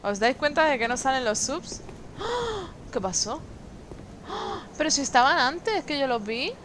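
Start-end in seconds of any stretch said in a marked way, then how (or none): 2.2-2.7 high, soft shocked gasp; grito ahogado. Real, not acted. Notices subs counter is broken. 4.3-4.6 soft, airy gasp of shock; grito ahogado. Real, not acted. Shock at missing subs counter.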